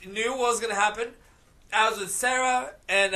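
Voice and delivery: ordinary talk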